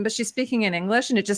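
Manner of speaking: usual speaking voice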